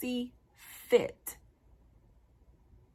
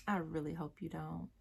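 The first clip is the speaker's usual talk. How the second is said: much softer, heartfelt; quiet sincere hope; 8 dB quieter than usual. Real, not acted. Pleading with fathers, quiet and earnest. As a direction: drop the volume and mean it.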